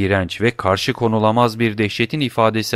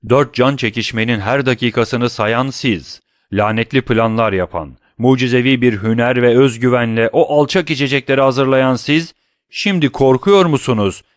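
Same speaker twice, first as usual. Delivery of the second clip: growing fury; 3 semitones higher, 5 dB louder than usual. Acted. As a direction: let the anger mount to the end. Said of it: "giderek artan bir taşkınlıkla".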